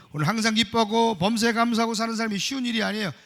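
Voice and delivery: ordinary talk, nothing marked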